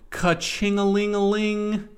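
high, slow sing-song ring; playful high-pitched celebration; gleeful cash-register jingle. Pretend. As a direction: chime it out like a ringing till. Celebrating sales, embellished ka-ching.

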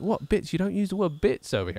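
high-pitched, puzzled English voice; imitated English accent. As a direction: query it as a baffled Englishman. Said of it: "having an Englishman on the show".

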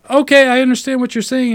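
strained cheerful phone-agent cut-in; forced customer-service voice. Retold or imitated. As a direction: push past the caller with fake patience. Him cutting in with solution.

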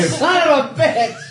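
frustrated yell. Real, not acted. Bad news in the game.

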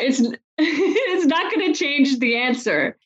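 quoting husband through a laugh; amused, laughing it off. Retold or imitated. Husband laughing at wife's doubt.

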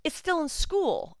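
high, drawn-out sarcasm; sarcastic. Real, not acted. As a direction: stretch it out high with mock wonder. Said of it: "incredibly sarcastic here".

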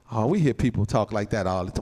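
ordinary delivery

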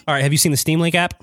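talking while crunching pork rinds; words round a crunchy bite; crunching chips. Real, not acted. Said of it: "I'm sorry for the crunching sounds".

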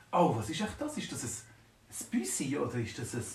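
plain reading voice

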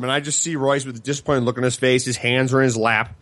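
ordinary talk

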